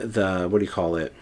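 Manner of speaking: ordinary talk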